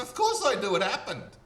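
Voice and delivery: laughing, high and loud; laughing reply. Retold or imitated. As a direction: laugh it out, sure of yourself. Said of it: "I said".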